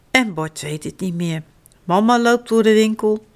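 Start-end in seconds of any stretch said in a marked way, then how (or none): none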